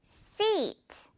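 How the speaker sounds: "Seat" with a long spread ee; smile vowel. Shown as a demonstration. Teacher repeating the wide-mouthed seat. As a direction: pull the lips wide on "Seat". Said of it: "입 길게 양옆으로 찢어줘야 돼요".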